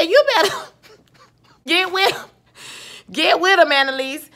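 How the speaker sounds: high, loud, laughing egging-on; hyped cheering on. Retold or imitated. Viewer cheers Annalise on during a showdown, hyped. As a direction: whoop it up as you cheer her on.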